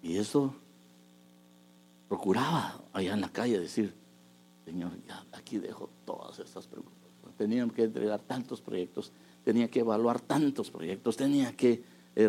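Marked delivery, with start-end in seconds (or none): 4.6-7.4 hushed, breathy plea to God; a quiet whispered prayer on the way home. Retold or imitated. Quiet prayer leaving worries behind.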